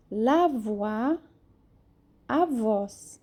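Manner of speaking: ordinary talk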